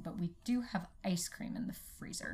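soft secretive whisper. Real, not acted. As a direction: whisper it like a treat.

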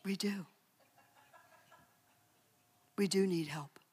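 whispered aside, no voice at all; a hushed, teasing whisper. Real, not acted. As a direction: lean in and whisper it to the room. Confessional aside.

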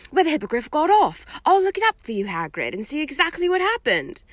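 put-on high, quick eager girl; eager helpful schoolgirl voice; Hermione voice. A narrator's reading.

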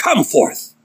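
high, ringing summons; acted authoritative call; commanding. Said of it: "The same authority by which he raised Lazarus from the dead".

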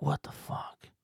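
breathy whisper, stunned; a private, whispered what the fuck; whispered disbelief. Retold or imitated. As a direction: hush it under the breath. Baffled inner reaction.

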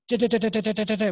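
rapid-fire "da-da-da" mock chatter; imitated nervous rambling. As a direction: imitate someone running their mouth in a panic. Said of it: "they just run their mouth".

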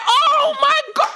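high, drawn-out cry of disbelief; shocked exclamation. Retold or imitated. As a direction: stretch it out, high-pitched and aghast. Shocked reaction to mum's revelation.

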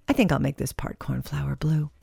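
a soft private whisper to yourself; whispered thought. Pretend. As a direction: murmur it as if absorbed in coloring. Voicing a colorer's inner thoughts.

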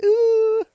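very high, loud "ooh"; long high-pitched mock-scared "ooh"; high scared squeal. Imitating own scared reaction in bed.

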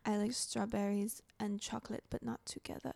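put-on soft Portuguese accent drifting into French; quiet, French-sounding try at a Portuguese accent; attempted Portuguese accent. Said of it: "No, that was French".

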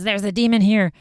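loudly hammed-up spooky warning; creepy demon warning. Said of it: "grabs Billy and says".

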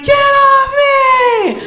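high, drawn-out retold scream; hammed-up high-pitched cry of protest; mock screaming. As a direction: scream it high, every word stretched. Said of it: "now I'm screaming like".

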